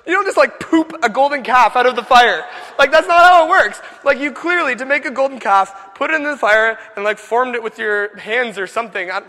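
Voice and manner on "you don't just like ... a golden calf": high, loud exasperated protest; loud incredulity. Acted. Preacher scoffing at Aaron's calf excuse, loudly incredulous. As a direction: ridicule the excuse at full volume.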